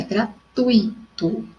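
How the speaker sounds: gratuito slowly, syllable by syllable, gra-TUI-to; slow corrective gra-TUI-to; stress on TUI. Shown as a demonstration. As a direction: spell out gratuito in beats, strong "tui". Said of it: "a sílaba tônica é o tui".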